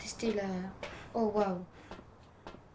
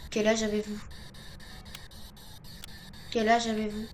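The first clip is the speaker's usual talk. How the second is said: calling out to a ghost. Real, not acted. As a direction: project the question so the spirit hears.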